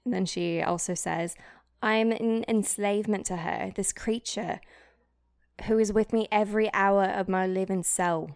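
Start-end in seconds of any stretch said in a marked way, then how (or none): 1.8-8.3 solemn put-on British diary reading; theatrical British accent. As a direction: read it as a tormented British diarist. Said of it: "British accent".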